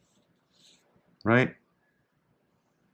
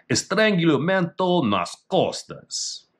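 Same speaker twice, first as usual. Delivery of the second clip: Portuguese reading; 9 semitones higher, slower than usual. Shown as a demonstration. Reading Portuguese technique name.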